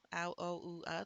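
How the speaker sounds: ordinary talk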